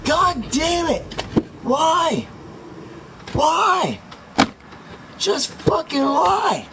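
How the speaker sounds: screaming high-pitched gamer rage; game rage. Real, not acted. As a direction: shriek at the game in fury. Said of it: "I'm losing my voice".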